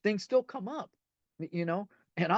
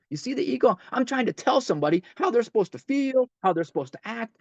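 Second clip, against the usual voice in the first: ordinary delivery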